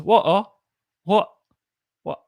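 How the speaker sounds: high, clipped "Water, what, what?"; mock British meme. Retelling the meme, mock British. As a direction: imitate the meme Brit fading out. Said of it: "no, it was".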